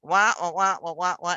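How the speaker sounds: low, droning wah-wah imitation; Charlie Brown teacher. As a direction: mimic a muffled cartoon adult's wordless drone. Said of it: "they hear the Charlie Brown teacher".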